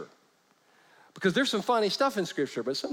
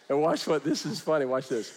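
ordinary talk, nothing marked

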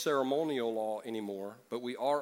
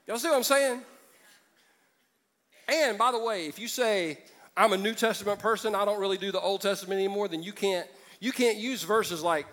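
loud, high, driving sermon voice; impassioned pastor building his case; full-force preaching; 7 semitones higher, 6 dB louder than usual. Acted. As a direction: preach it out to the back row.

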